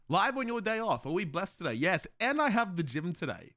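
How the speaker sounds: usual speaking voice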